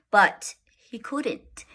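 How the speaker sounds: ordinary delivery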